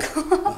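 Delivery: short amused laugh; a quick knowing chuckle; laughing off the teasing. Acted.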